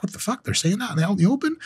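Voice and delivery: high, stunned disbelief; retold shock at the news. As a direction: gasp it out in shock.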